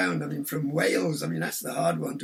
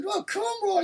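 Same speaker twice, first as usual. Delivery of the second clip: Cornish farmer; slower than usual. Retold or imitated. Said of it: "He said".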